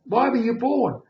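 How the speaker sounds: nurse asking. Retold or imitated.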